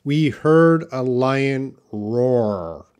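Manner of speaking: "we heard a lion roar" very slowly, word by word; "we heard a lion roar" with each R carefully sounded; slow example sentence. Shown as a demonstration. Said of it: "how to pronounce roar".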